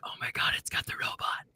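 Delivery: hushed, awed whisper. Retold or imitated. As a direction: breathe it out in disbelief. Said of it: "I was like".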